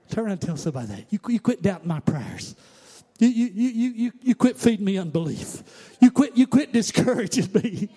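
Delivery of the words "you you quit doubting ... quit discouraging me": higher and louder, hammering each "you", building to a shout; preaching at full force. Acted. Preacher voicing a believer telling doubters to quit, building to a shout.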